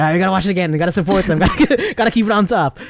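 mock rally cry, pitch climbing as it goes; retold stoked rallying. Fan rallying a friend for a rewatch, stoked. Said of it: "I was, like, super stoked".

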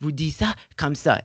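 high, hammed-up French scolding; snooty Parisian tutting in French; mock France French. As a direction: look down your nose at a learner's French. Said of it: "they're like".

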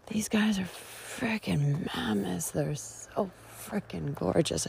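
slow awed whisper. Real, not acted. Gazing at scarred redwoods, slow awed whisper. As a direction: savor it in a whisper.

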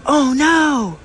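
put-on high, loud "Oh no!" of a girl who just lost something; startled cry. Girl in the next stall crying out in dismay. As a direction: yelp it out in sudden dismay. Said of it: "all of a sudden I hear her go".

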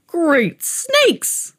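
lightly croaked high exclamation; croaking old man. A narrator's reading. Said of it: "croaked the old man".

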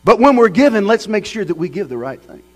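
high and loud pulpit exhortation; preaching at full force. Acted. Preacher exhorting the church about giving, full force.

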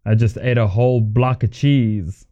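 ordinary talk, nothing marked

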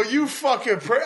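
high, slow, cut-off curse; retold mock outrage. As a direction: start cursing the prankster in relief. Retold cursing of prankster cop.

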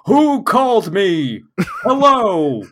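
loud, high, slow robotic shout; Metatron robot voice. Acted. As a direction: bellow into the phone like an oblivious robot. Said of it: "robot voice just being like".

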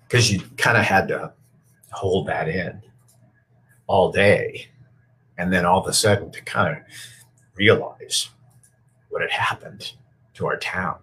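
halting, emotion catching the voice; choked up. Real, not acted. Reporter recalls holding it in all day, choked up. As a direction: struggle to get it out, pausing between phrases.

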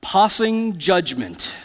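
snooty British pig, drawn out slowly; haughty, slow British pronunciation with chin lifted; British accent. Acted. Pig boasting to a porcupine in a movie, haughty British accent. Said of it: "lifts his little chin in a British accent".

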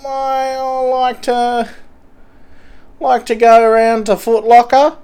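high, hesitant junkie voice; a dazed, stammering street addict; twitchy slurred sniffing addict. Pretend. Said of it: "a junkie from a train station".

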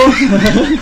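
a short, high amused laugh; burst of laughter. Real, not acted. Amused laugh while the host interrupts. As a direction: laugh it off mid-story.

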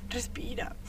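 hushed breathy whisper; girl whispering to herself through the panic; whispered calming. Acted. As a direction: whisper yourself back to calm.

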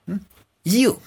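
ordinary delivery

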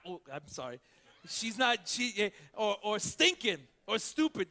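ordinary delivery

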